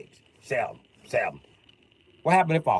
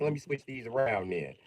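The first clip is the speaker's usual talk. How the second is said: usual speaking voice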